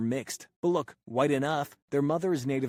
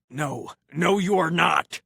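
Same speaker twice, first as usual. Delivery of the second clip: a loud, higher anime hero voice, rising into a yell; Gohan impression; 5 semitones higher, 8 dB louder, slower than usual. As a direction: channel an angry anime kid hero. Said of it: "does his best Gohan impression".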